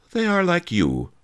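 high, sweet sage's voice; sly sage. A narrator's reading. Master Li mocking the boastful thug, high-voiced. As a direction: answer the bully in a high character voice.

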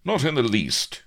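high, loud, clipped denial. Acted. Captain answers pirate leader's challenge, clipped. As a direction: cut the question off crisply. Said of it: "clipped Avery".

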